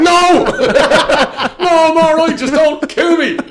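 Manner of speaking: high, loud laughing plea; mock terror, laughing. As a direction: beg for your life through giggles. Mock terrified reply, laughing.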